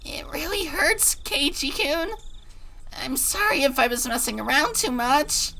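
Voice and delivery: high pained pleading; pained apology. Acted. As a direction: apologize through pain. Rena in pain apologizing.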